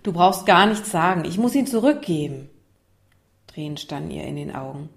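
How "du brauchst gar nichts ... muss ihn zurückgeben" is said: mildly exasperated, raised-voice statement; annoyed. Acted. Isa pre-empts Lukas's reproach near tears, annoyed. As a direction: cut him off irritably, starting loud. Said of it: "Isa war genervt".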